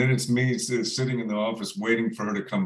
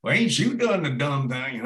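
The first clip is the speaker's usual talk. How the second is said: hammed-up Southern accent; 6 semitones higher than usual. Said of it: "put on her big, like, Southern accent".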